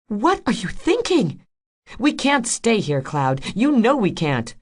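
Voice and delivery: high, loud and urgent; heated pleading. A narrator's reading. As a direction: make him listen. Rose confronts Freecloud loudly.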